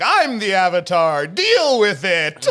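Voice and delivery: loud, slow, defiant declaration; shouting. Retold or imitated. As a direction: belt it out, drawn out and defiant. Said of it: "was like".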